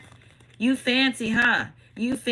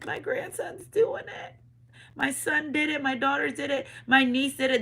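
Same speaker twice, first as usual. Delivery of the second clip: proud departed grandparent; 5 semitones higher than usual. Acted. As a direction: lift the pitch and boast like a proud grandma.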